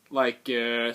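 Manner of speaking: ordinary talk, nothing marked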